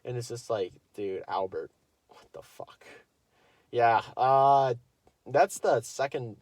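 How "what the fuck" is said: breathless disbelieving whisper. Real, not acted. Alone with his viewers, whispering disbelief. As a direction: hush it like a secret to the viewers.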